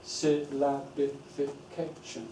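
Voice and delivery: slow, soft, one syllable at a time; measured syllable-by-syllable demo. Shown as a demonstration. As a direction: break it into separate beats, quietly. Clapping out jargon syllables.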